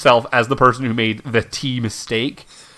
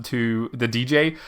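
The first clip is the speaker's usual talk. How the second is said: ordinary talk, nothing marked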